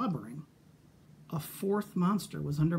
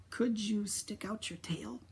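unvoiced nervous whisper; 3 semitones higher, 5 dB quieter than usual. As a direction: breathe the question, barely voiced. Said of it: "I whispered".

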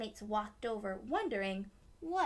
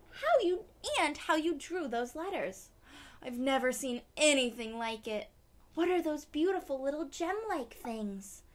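much higher, louder amazed praise; excited wow; 3 semitones higher, 6 dB louder than usual. Acted. Classmates gushing over the license plate. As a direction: gush over it.

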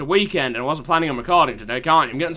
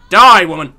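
battle shout; 10 dB louder than usual. Real, not acted. Attacking enemy in game.

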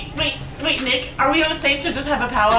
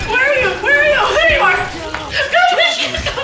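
pitched-up, alarmed calling; frantic; 8 semitones higher, faster than usual. Real, not acted. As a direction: call out urgently for a lost teammate. Searching frantically amid zombies.

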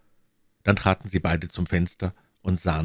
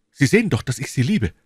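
ardent protest; 8 semitones higher, 6 dB louder, faster than usual. Acted. Lover protesting his love to her.

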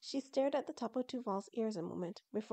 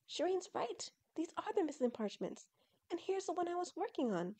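high, thrilled voice; excited discovery; 9 semitones higher than usual. Acted. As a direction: gush over the find. Tillin discovers the missing parchments.

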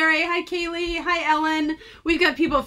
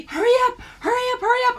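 high, louder mock rushing; imitated urgent nagging; 12 semitones higher, 6 dB louder, much faster than usual. Retelling partner's messages to hurry, mock urgent. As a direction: hammer it out as a pressing repeated plea.

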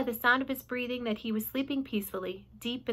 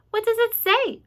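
much higher and louder, eager; high eager Charlotte; eager question; 13 semitones higher, 9 dB louder than usual. Acted. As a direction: ask it up high, keen to know.

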